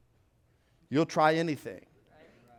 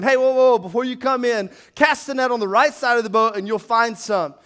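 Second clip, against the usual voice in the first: louder, higher call from the shore; a hint of Jesus calling out; 6 semitones higher, 8 dB louder than usual. Jesus calling to the fishermen from the shore, loud and friendly. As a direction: call out to the boat, lightly voiced. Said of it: "and he said to them".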